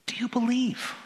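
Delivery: high, half-whispered question; soft, breath-led appeal; hushed question. Acted. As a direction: ask it gently, almost whispering. Gentle question posed as Jesus.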